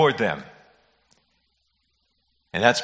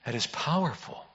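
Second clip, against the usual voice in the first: much softer, leaning on "powerful"; hushed awe; 9 dB quieter than usual. Real, not acted. Pastor repeating to congregation, soft lean on "powerful". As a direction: press "powerful" gently in a hush.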